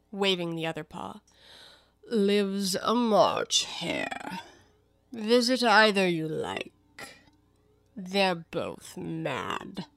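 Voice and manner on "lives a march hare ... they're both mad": languid cat, extremely drawn out; Cheshire Cat. Acted. The cat tells Alice of her mad neighbours, languid.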